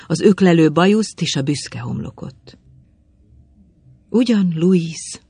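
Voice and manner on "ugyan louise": plain reading voice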